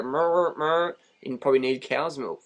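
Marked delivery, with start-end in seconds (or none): 0.0-0.9 higher mock mooing; imitated cow moo. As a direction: moo like a cow, half in words. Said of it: "if you got four legs and go".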